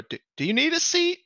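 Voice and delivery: very high, fast nervous question; hammed-up nervous host; flustered manager. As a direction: squeak it out, thrown by the odd guest. Manager asks Irma about seat.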